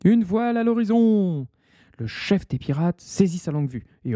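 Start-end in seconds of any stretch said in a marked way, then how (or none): 0.1-1.5 high call from the masthead; lookout's cry. Acted. Lookout calling down to the pirates, high cry. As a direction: shout it up from the mast.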